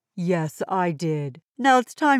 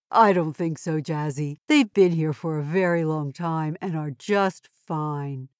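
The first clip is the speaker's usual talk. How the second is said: ordinary talk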